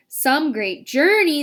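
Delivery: a touch of tired complaint; centipede voice. A narrator's reading. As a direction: drag it out, worn from the trip. Centipede complains after rough journey.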